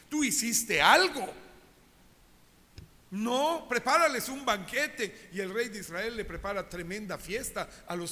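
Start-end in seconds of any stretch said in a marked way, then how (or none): 0.1-5.3 emphatic rebuke. Acted. Prophet corrects the king emphatically.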